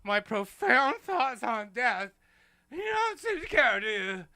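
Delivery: hammed-up offended host whining at laughing guests; mock wounded whine. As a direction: pout like a hurt child. Host pouts over guests laughing.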